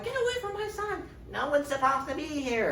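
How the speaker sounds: very high falsetto mock mother; a mother protecting her son, high mock scream; shrill mother. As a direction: shriek it in a put-on high voice. Catelyn defending her son.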